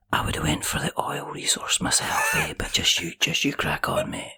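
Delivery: put-on whispered Scottish accent. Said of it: "whispered and just went".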